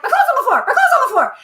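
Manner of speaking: high, loud, laughing panic; panicked cry. Retold or imitated. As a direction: shriek it in a frantic rush. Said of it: "And I was like".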